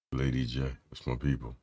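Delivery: usual speaking voice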